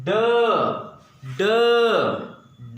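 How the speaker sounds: D as a short "duh", alone; isolated first sound. Shown as a demonstration. Teacher showing the first sound, D said as a short "duh". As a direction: give just the consonant D, cut short, with pauses. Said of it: "the first one sound is".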